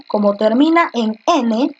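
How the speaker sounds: ordinary talk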